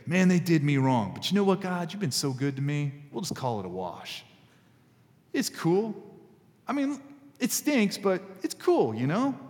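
a breezy, easygoing shrug of a prayer; casual shrug. Pretend. Imagined David shrugging off his enemies to God, laid-back. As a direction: let it go like it's no big deal. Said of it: "expected David to say".

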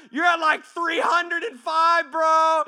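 high, loud score-keeper; exasperated comic yell; shouted warning. Acted. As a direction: holler the tally at a buddy. Acted score-keeper warning a friend.

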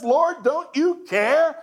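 slow, high accusing question; accusing Martha. Acted. Martha storming in on Jesus, accusing. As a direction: lean on you like an accusation. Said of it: "she interrupts the teaching and says".

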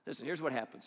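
usual speaking voice

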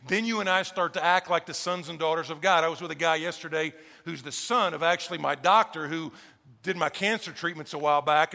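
ordinary talk, nothing marked

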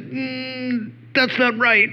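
high-pitched, drawn-out hum, then disapproval; hesitant objection. Retold or imitated. Student finally correcting her friend about moving in, hesitant objection. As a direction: imitate a reluctant student speaking up.

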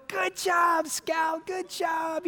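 high, bright mock praise; praising. Dad praising his daughter after her swim, high.

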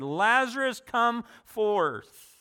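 high, loud summons into the tomb; ringing command to the dead; commanding call. Acted. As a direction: call him out with full authority. Jesus calls Lazarus from tomb.

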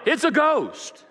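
high frightened yell. Acted. Disciples in the storm yell in fear. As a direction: yell it in terror. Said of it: "And they yell".

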